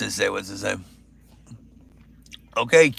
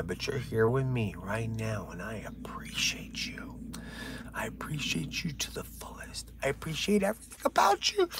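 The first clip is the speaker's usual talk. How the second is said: emotional whisper; 4 semitones lower, 12 dB quieter than usual. Pretend. Thanking his live viewers in a mock-tearful whisper. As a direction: breathe the thanks like a confession. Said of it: "I'm working myself up".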